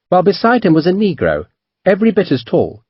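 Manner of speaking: usual speaking voice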